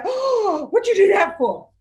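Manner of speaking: put-on high alarmed cry of the kids. Kids shocked at the driver kicking the trailer, alarmed. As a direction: pitch it way up like worried kids.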